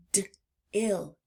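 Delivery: D, ill split by a pause after D; segmented first sound. Shown as a demonstration. Teacher lets children hear the first sound, segmented. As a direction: detach D, pause, then say ill. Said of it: "Do you hear D".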